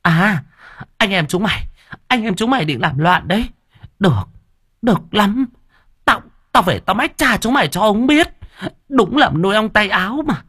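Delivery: very high, outraged threats; screeching stepmother. A narrator's reading. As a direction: rail at both siblings at the top of your range. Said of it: "Ả lại gào lên".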